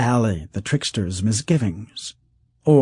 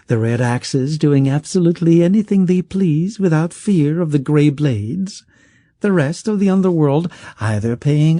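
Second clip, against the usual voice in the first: high, coaxing sales pitch; smooth pleading rich man; 7 semitones higher than usual. Acted. Rich man talking Kesk out of killing him, high and coaxing. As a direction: sell him the dream with an axe at your neck.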